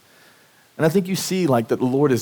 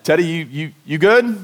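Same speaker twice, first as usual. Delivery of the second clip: raised, higher checking-in call; calling to another room; 4 semitones higher than usual. Retold or imitated. Dad checks on his son from the kitchen, calling out higher. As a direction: call out a question to your kid next door.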